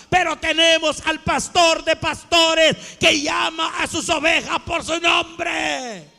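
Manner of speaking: loud exhortation, rising in pitch; preaching climax. Real, not acted. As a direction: build to a ringing shout. (prédica a voz en cuello)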